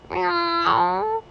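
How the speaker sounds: high, drawn-out mewing meow; cat meow. Acted. A big cat outside the window calling, meow. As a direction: mew like a curious cat.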